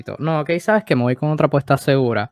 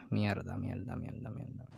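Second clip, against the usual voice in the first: muttered curses, much softer; cursing under breath; 4 semitones lower, 15 dB quieter, slower than usual. Real, not acted. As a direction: swear quietly to yourself. Frustrated over a hard draft pick. (maldiciendo entre dientes)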